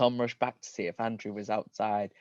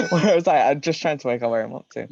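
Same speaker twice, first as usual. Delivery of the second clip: flustered; 4 semitones higher, 9 dB louder, faster than usual. Real, not acted. Recovering from laughter, losing place.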